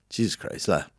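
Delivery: breathed disbelief. Retold or imitated. Reacting to gross story.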